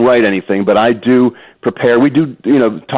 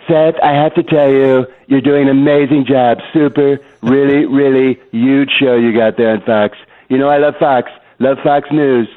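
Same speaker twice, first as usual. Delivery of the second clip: Donald Trump; 3 semitones higher than usual. Pretend. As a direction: lay on the flattery like the president. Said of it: "You do, you do Trump".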